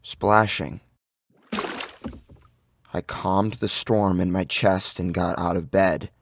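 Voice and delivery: ordinary delivery, nothing marked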